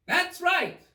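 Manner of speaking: high, slow imperious shout; queen shouting. A narrator's reading. Queen demands news of execution, high shout. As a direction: bellow down the garden. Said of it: "shouted the queen".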